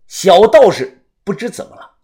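ordinary delivery, nothing marked

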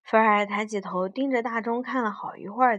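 ordinary delivery

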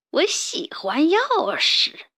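a hint of a menacing rat savoring the words; a touch of a villain rat eyeing the key; sinister villain. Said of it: "坡老大阴险地笑道".

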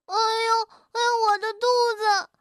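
high, quick bellyache wail, acted; crying out in pain. A narrator's reading. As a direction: cry out as if your stomach hurts. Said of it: "跳跳喊道". (肚子疼得叫唤)

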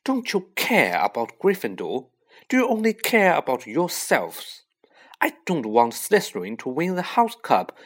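heated, indignant scolding; cross telling-off in a storybook reading; angry hissing. A narrator's reading. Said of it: "hissing at them like an angry goose".

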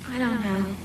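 quietly unsure, as if holding back sadness; sad. Acted. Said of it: "why are you so sad".

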